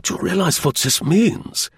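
high, excited German general; feverish excitement. Acted. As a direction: ask it with feverish eagerness. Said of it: "charged with feverish excitement".

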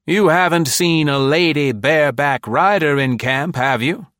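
high, loud jovial man; cheerful eccentric boss; jovial big man voice. A narrator's reading. Caspier voiced.

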